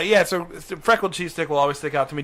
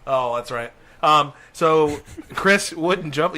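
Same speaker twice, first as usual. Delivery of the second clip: ordinary delivery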